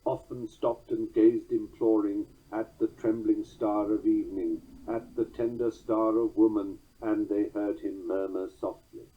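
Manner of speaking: rock the strong-weak beat; steady Hiawatha chant; trochaic reading. Shown as a demonstration. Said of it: "As is Longfellow's Song of Hiawatha".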